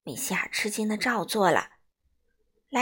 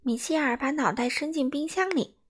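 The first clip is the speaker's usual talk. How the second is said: ordinary delivery, nothing marked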